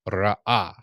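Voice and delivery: Ra'ah, said slowly with a long open ah; Ra'ah drawn out as one long ah; Hebrew word ra'ah. Shown as a demonstration. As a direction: model Ra'ah loudly for the class. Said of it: "means he saw".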